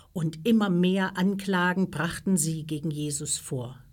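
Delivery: ordinary delivery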